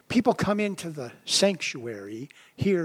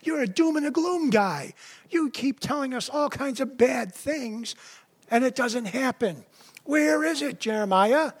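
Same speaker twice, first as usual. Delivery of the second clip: mocking taunt; 7 semitones higher than usual. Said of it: "What they're really saying is".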